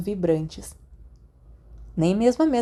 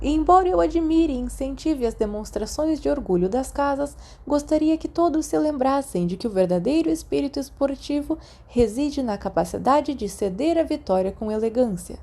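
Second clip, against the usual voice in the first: put-on a higher, proud old headmaster addressing the hall; headmaster's speech; 7 semitones higher than usual. A narrator's reading. Dumbledore addressing the students at the feast, proud and higher. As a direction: speak to the whole hall like a beaming headmaster.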